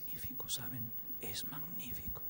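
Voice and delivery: near-whispered marvelling; hushed awe. Real, not acted. Preacher marvelling to listeners at God's word, hushed awe. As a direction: drop to a soft, breath-filled aside. Awed aside after the loud proclamation.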